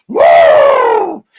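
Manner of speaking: loud high whoop with a cry in it; whooping shout. Real, not acted. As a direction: blow off steam with a wild whoop. End of angry rant.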